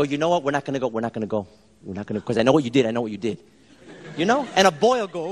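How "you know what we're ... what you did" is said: low, muted mock-stern dad voice; teasing sternness. Dad messing with his little daughter, low teasing sternness. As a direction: drop low, knowingly accusing. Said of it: "So I'm messing with her".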